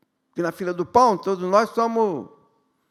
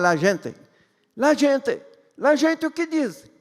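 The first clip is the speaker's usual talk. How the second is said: put-on Italian accent rising higher and higher; hammed-up Italian accent, building up; jolly italian pizza chef; 6 semitones higher than usual. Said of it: "O sotaque italiano é muito engraçado".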